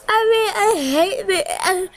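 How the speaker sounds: hammed-up sobbing through the words; mock emotional crying. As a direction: play at falling apart in tears. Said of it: "I'm so emotional right now".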